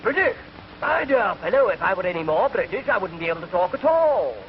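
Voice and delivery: high, indignant plummy English; affronted upper-class gentleman; posh English accent. Retold or imitated. Englishman answering the waiter, plummy posh accent. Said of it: "Man looked up and said".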